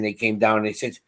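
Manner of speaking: ordinary delivery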